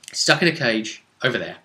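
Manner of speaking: ordinary talk, nothing marked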